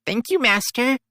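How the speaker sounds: put-on high, bright little girl thanking her master; sweet child voice.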